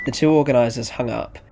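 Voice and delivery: ordinary delivery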